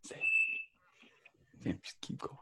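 quiet hushed aside. Real, not acted. Hushed aside during the call.

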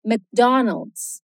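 McDonald's in English, no vowel between M and C; McDonald's said slowly in American English; English McDonald's, slow and clear; English pronunciation. Shown as a demonstration. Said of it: "Em inglês é McDonald's".

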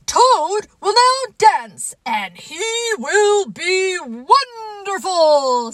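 high, loud, stretched-out proclamation; strange announcer voice. Acted. Strange voice introducing Toad's dance, grand drawn-out announcing. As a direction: draw out each word like a grand announcer. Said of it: "said the voice".

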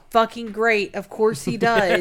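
loud, sarcastic annoyance. Retold or imitated. Bride annoyed at Dylan going out, loud sarcasm. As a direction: bite it out sarcastically.

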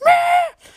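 mock whiny "meh" squawk; comic high squeal of disdain; loud high-pitched bleat. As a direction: blurt a high, drawn-out bleat. Mimed noise in recap.

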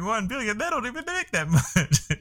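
high, laughing mock disbelief. Mocking quote of the listeners.

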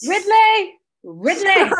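loud high call to the dog. Real, not acted. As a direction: shout the dog's name across the room. Calling the dog loudly.

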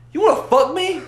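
mock outraged squeak of disbelief; shocked disbelief. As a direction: voice leaps way up, loud. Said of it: "Sam goes".

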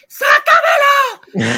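high drawn-out shriek; yelling sácamelo on the operating table; mock scream. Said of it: "yo gritando que".

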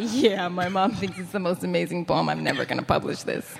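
low, dismissive retold thought; sceptical inner thought. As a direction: drop your pitch, brushing off mom's praise. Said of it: "And I thought".

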